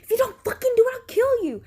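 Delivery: high, heated imitation of a threat; mock threat. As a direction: act out his threat, pitched high. Voicing the man's threat.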